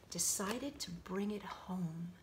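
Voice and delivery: whisper. A narrator's reading. Narrator whispers aside.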